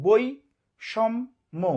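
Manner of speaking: বৈষম্য very slowly, in three separate beats; syllable by syllable. Shown as a demonstration. Teacher showing students how to count syllables, saying বৈষম্য slowly in three parts. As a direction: break বৈষম্য into three drawn-out syllables with pauses between. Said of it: "তিনবার উচ্চারণ করলাম".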